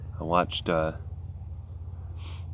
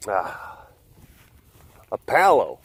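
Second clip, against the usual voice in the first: normal talking voice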